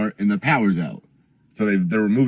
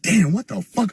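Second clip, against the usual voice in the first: shocked; 9 semitones higher than usual. Retold or imitated. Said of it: "I'm shocked immediately".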